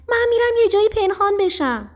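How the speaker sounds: high, loud squeal of a panicked mouse; alarmed cry. A narrator's reading. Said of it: "موش فریاد زد". (فریاد بلند و زیرِ موش ترسیده)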